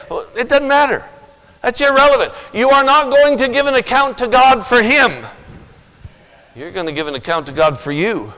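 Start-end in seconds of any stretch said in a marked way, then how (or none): 0.1-5.3 high, loud pulpit declaration; fiery preaching. Real, not acted. Preacher rebuking the congregation at full force. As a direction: preach it hard to the room.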